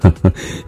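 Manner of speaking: quick, full-bellied chuckle; hearty laugh. A narrator's reading. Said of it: "Vikrant जोर से हंसते हुए कहा".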